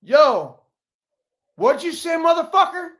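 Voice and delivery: loud, high challenge to a heckler; angry confrontation. Retold or imitated. Rendon confronting the heckling fan, loud and angry. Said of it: "And he goes".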